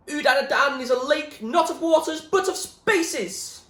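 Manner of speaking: high, loud, slowing to a drawn-out end; mad shouting. Acted. William shouts nonsense nobody listens to, loud. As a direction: preach the madness at full voice. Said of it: "his mad shouting".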